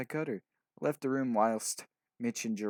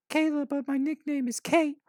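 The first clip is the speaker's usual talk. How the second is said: put-on high-pitched girl, louder; 6 dB louder than usual. A narrator's reading. Orphan girl tells the boys about her owner, high voice.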